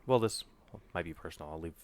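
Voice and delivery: usual speaking voice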